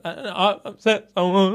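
stammered, mildly trembling imitation; shaking voice. Imitating the woman's shaky voice as she apologises on camera. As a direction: let the voice wobble with a hint of tears. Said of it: "And her voice shaking".

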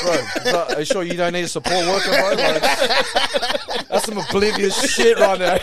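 laughing through a mocking jab; teasing while cracking up. Real, not acted.

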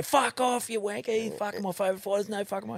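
very high-pitched scoffing fan; mock outraged disbelief. Friend scoffs in disbelief, acted.

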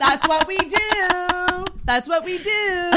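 slow, high fake sob; mock crying. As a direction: wail it in mock despair. Mock lament about women.

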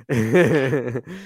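loud, rising burst of laughter; real helpless laugh. Real, not acted. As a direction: crack up at your own fumble. Cracks up after fumbling his words.

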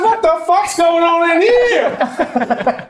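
mock sheriff breaking up a fight; sheriff arriving. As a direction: burst in as the shocked sheriff. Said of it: "pretend you're the sheriff".

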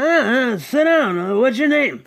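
high, slurred put-on voice; slurry high-voiced invitation, imitated. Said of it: "and he's like".

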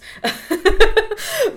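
high, loud bursting laugh; burst of laughter. Real, not acted. Laughing at never speaking to them again, loud high laugh. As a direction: let out a real laugh.

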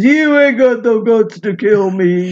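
lightly voiced movie villain; movie tough guy. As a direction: growl it like a B-movie heavy. Retelling a movie villain's line.

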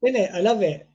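ordinary talk

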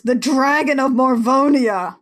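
hammed-up, swelling announcement; mock dramatic proclamation. Host mimicking Lexa identifying the dragon, mock dramatic. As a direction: proclaim it like a cartoon villain.

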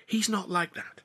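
a hint of a defensive young woman; Tilly's voice. Tilly's female voice.

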